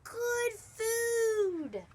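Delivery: put-on high child voice, drawn out; insistent, high-pitched kid; little girl pleading. A narrator's reading. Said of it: "Taya said".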